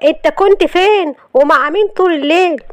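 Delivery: high-pitched, heated interrogation; angry. Acted. Wife asking where husband spent the night, angry. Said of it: "قالت له بغضب".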